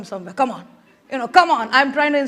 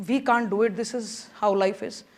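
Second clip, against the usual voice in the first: resigned people; 6 semitones lower, 8 dB quieter than usual. Retold or imitated. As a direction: sigh it out as if nothing can change. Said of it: "So they'd say".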